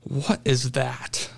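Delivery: slow, hushed disbelief; awestruck. Retold or imitated. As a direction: stare at the fish landing on the deck. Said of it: "and I just went".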